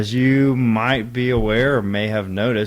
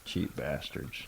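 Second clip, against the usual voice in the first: muttered; 9 semitones lower, 13 dB quieter, slower than usual. Real, not acted. Muttered complaint.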